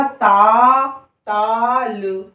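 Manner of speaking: "ताल" drawn out very slowly; slow reading demo. Shown as a demonstration. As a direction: stretch the vowel in "ताल". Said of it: "दो तीन बार अभ्यास करिए". ("ताल" को बहुत धीरे खींचकर पढ़ना)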